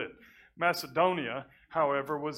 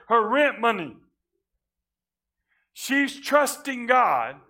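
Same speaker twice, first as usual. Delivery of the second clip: loud, high, ringing preacher's climb; preaching at full force; 9 semitones higher, 14 dB louder than usual. Acted. Preacher swelling to the congregation, loud and high. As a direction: drive the point home to the congregation.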